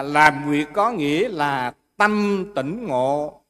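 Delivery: slow, measured recital of a Buddhist verse; verse recitation. Acted. Teacher quotes a master's verse to students, slow solemn recitation. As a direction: recite the verse line by line, drawing out each word.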